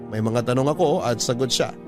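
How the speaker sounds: normal talking voice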